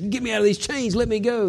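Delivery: half-hearted shouting back in protest; mock shouting. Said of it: "I'd be shouting back at them".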